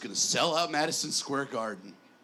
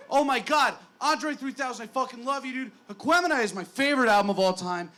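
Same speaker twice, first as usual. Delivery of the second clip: much higher, louder fanboy gush; starstruck gushing; 10 semitones higher, 6 dB louder than usual. Retold or imitated. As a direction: blurt it out to your idol, overjoyed. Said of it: "I'm like".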